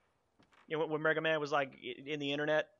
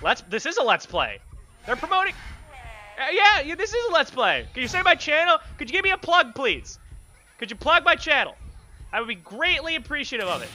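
joking plea; 6 semitones higher, 8 dB louder than usual. Real, not acted. Asking ghosts to plug channel.

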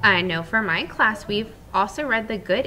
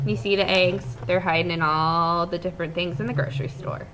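usual speaking voice

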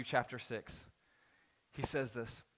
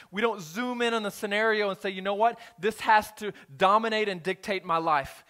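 preaching at full force; 2 semitones higher, 5 dB louder than usual. Real, not acted. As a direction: lift the room, proclaim it. Preaching climax.

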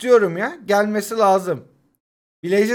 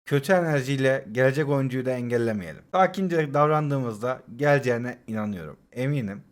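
softer, lower, forced-serene self-soothing; mock calm; 6 semitones lower, 8 dB quieter than usual. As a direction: pretend meditation, holding the anger down. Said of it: "Evet sakinim". (sahte bir sakinlikle, alçak ve yumuşak)

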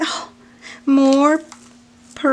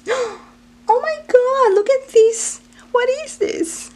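much higher, louder squealing surprise; delighted squeal; 4 dB louder than usual. Real, not acted. Finding a cute pet charm, she squeals in surprise.

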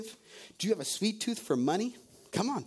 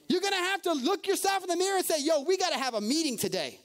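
preaching at force; 6 semitones higher than usual. Acted. Preacher confronting the church, emphatic and rising. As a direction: drive home you the problem.

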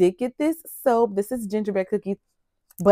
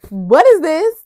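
surprised exclaim; 9 semitones higher, 11 dB louder than usual. Real, not acted. Spots surprise item in box.

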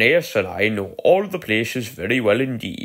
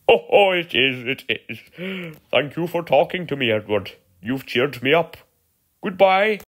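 cheered up; 6 semitones higher, 3 dB louder than usual. A narrator's reading. Skarloey is touched and cheered.

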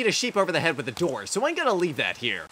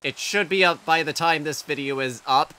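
usual speaking voice

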